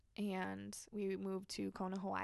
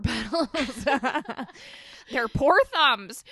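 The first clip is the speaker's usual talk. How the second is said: high, loud, drawn-out laughing lament; amused mock pity, loud and stretched; 4 semitones higher, 14 dB louder than usual. Real, not acted. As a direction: say it through a burst of laughter. Laughs about the flower petals.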